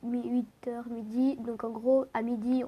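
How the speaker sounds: normal talking voice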